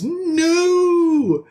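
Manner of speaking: high, drawn-out cry of no; mock scream. Storyteller acting Jake at his friend's death, mock scream. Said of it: "Jake screams".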